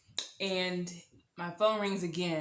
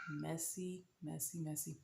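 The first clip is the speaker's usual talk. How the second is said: sighed, very soft low aside; hushed disapproval; 3 semitones lower, 14 dB quieter than usual. Real, not acted. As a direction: sigh it out under your breath. Disapproving aside after pregnancy reveal.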